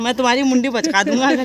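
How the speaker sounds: loud mock threat about posting the video. Real, not acted. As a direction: bark the threat in jest.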